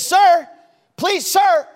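high, loud exasperated call; upset pastor. Retold or imitated. Pastor calling after a man walking out, upset shout. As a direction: call after someone who keeps ignoring you. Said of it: "he gets upset on the platform".

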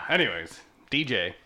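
ordinary talk, nothing marked

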